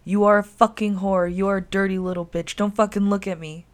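loud, contemptuous insults; hurled curses. A narrator's reading. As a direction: spit the insults at her. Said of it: "hurled curses at her".

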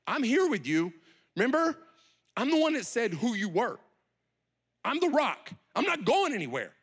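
high, insistent declaration of loyalty; emphatic pledge. Acted. Peter pledging to Jesus he will stay, emphatic.

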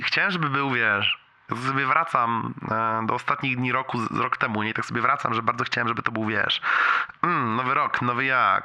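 normal talking voice

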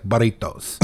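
imitation Spanish accent; mock Spanish accent. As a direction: say burritos in a fake Spanish accent. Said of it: "she'll do a weird accent".

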